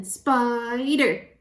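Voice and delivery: "Spider" stretched into two emphatic beats; syllable by syllable. Shown as a demonstration. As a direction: sound out "Spider", lengthening the first syllable. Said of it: "Two syllables".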